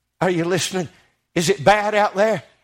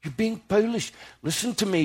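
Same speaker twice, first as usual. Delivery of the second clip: softer tender scolding of foolish disciples; compassionate rebuke; 7 dB quieter than usual. Retold or imitated. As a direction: chide them gently, like a sorry friend. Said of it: "He was compassionate".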